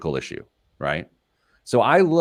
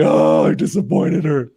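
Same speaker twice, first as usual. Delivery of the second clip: mock crying; 8 semitones higher, 7 dB louder than usual. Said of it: "cried myself through the toll roads".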